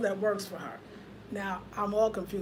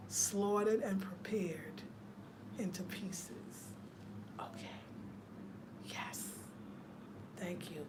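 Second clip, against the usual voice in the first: slow, much softer whisper; 6 semitones lower, 15 dB quieter, slower than usual. Real, not acted. Vlogger whispering how the animal was slaughtered. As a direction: hush it like a secret.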